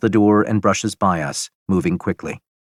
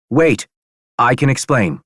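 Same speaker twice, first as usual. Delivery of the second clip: raised, higher urgent appeal; urgent plea; 5 semitones higher, 4 dB louder than usual. A narrator's reading. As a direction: priest hurriedly calling for calm. Priest calming people with drawn guns.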